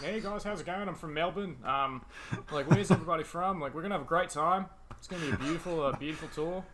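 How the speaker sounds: easygoing imitation of an Australian guide; Australian accent. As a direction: welcome tourists in put-on Australian. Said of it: "I did an Australian accent".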